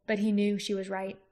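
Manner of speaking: ordinary delivery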